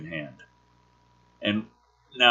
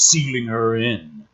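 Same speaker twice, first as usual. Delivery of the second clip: ordinary talk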